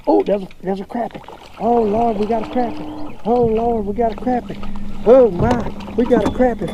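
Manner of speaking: high, thrilled cries over the catch; delighted angler shouting out his crappie; excited. Real, not acted. Fisherman to viewers on landing a crappie, thrilled.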